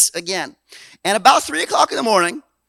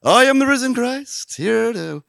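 mock pompous Jesus; much slower than usual. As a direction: parody a grand holy pronouncement. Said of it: "He didn't get up and go".

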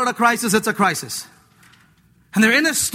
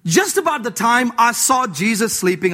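ordinary delivery

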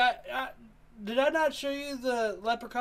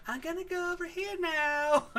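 put-on nervous kid voice; 9 semitones higher than usual. Kid voice repeated.

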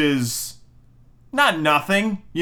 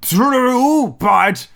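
high, loud villain conceding; a hammed-up pirate captain agreeing; Hook voice; 11 semitones higher, 5 dB louder than usual. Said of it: "And Hook goes".

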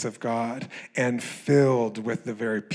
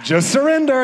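higher, louder shouted command; mock shout; 13 semitones higher, 8 dB louder than usual. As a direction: yell it at him like a laughing friend. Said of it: "My friend just leaves me there and goes".

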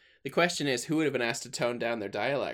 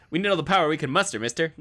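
fast, loud, higher Scottish brogue; loud quick Scottish engineer; Scotty impression; 7 semitones higher, 9 dB louder, much faster than usual. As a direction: bark it like Scotty on the intercom.

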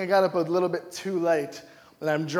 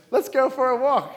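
higher, louder cheery suggestion; Johnny's suggestion; 7 semitones higher, 6 dB louder than usual. Retold or imitated. Preacher voicing a friend's cheerful walk idea. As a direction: pitch it up as a chipper friend. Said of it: "Johnny suggested".

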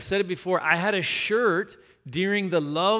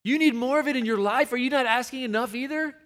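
retold incredulous reply; 8 semitones higher than usual. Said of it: "And I'm like".

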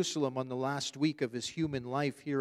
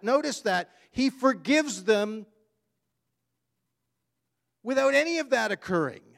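louder and higher, driving fast; full-force preaching; 5 semitones higher, 6 dB louder than usual. Acted. Pastor urging the church about forgiveness, fervent and loud. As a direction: hammer it home to the congregation.

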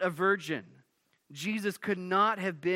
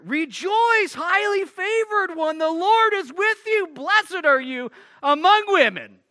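much higher, much louder ringing proclamation; emphatic angel greeting; 12 semitones higher, 11 dB louder than usual. Acted. Gabriel greeting Mary, emphatic and excited. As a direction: shout it out like an angel bursting with joy. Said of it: "emphatic here".

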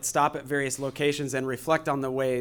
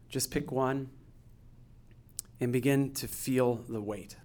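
guided meditation; 8 dB quieter than usual. Acted. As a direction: gently guide them into stillness. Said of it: "I'm gonna take us through a guided meditation".

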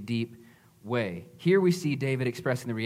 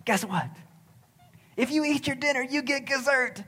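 put-on much higher, eager toddler voice; excited little girl; 11 semitones higher than usual. Dad imitating his daughter telling her brother about dessert, excited high child voice. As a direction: squeal it out like a thrilled three-year-old. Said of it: "And she's like".

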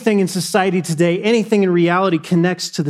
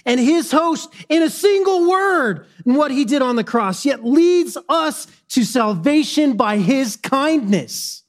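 preaching at full force; 10 semitones higher than usual. Acted. Pastor crying out to the congregation about his king. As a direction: lift the room, preach it out.

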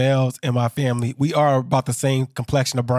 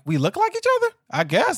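very high-pitched puzzled question; squeaky-high retold doubt; mock disbelief. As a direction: question it incredulously, voice shooting up. Acting puzzlement at cousins' resemblance.

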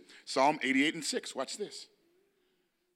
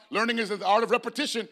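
normal talking voice

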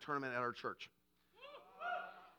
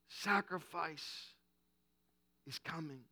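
very slow, much softer, drawn out; hushed proclamation; 2 semitones lower, 7 dB quieter, slower than usual. Acted. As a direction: let it hang like a solemn secret. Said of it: "God shouting throughout eternity".

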